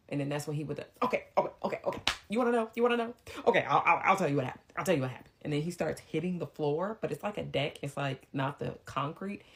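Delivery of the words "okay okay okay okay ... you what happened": rapid, repetitive, worked-up outburst; frantic giving-in. Retold or imitated. Imitating him cracking under mom's questions, frantic. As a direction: burst out in agitated, stumbling repeats.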